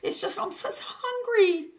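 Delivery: very high, quavering, stammering plea; wobbly pleading hedgehog. A narrator's reading. Terrified hedgehog begging the dragon, high and wobbly. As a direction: beg in a tiny trembling squeak, half laughing, half crying. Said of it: "pleading in a wobbly voice".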